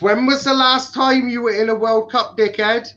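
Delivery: loud, high jeering jab; mocking taunt. Real, not acted. Teasing the Irish co-host about World Cups, loud high jeer.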